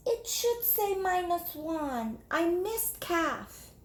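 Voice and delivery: high, soft and slow; timid little girl. Acted. Jamaica answering her teacher, timid. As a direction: answer shyly.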